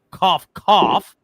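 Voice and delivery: exaggerated mock cough spoken as words; fake cough. Reading a chat jab aloud.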